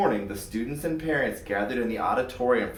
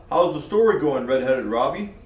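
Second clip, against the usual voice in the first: lightly voiced kid; 5 dB louder than usual. Max greets Robbie.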